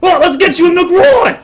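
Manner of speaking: very high, loud host; hearty dwarf father. Acted. Father offering drinks to guests, high and loud. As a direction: boom the offer.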